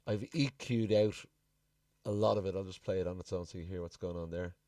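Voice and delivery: ordinary talk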